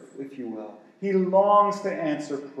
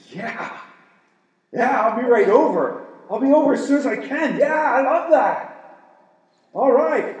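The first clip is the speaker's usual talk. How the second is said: eager; 7 semitones higher, 8 dB louder than usual. Pretend. Eager helper voiced, contrasted.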